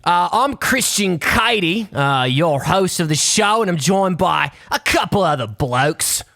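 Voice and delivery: podcast intro in a cartoonish Australian accent; Nick Kroll's Australian accent. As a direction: exaggerate a silly Australian accent. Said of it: "an impression of, uh, of Nick Kroll's Australian accent".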